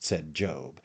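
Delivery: ordinary delivery, nothing marked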